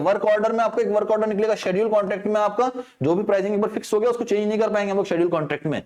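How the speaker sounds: fast explaining; speaking fast. Real, not acted. Teacher rushing through contract types for students, fast. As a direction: rattle through the explanation quickly. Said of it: "थोड़ा fast बोल रहा हूं".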